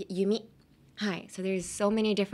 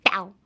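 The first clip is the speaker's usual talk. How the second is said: bow with a punched B; bow, hit the B hard; stress on the B; 6 dB louder than usual. Shown as a demonstration. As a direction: pop the B of bow. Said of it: "emphasis on the B".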